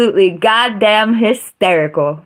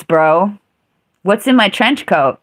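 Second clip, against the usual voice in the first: ordinary talk, nothing marked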